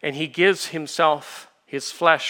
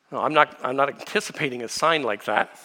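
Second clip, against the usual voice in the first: ordinary delivery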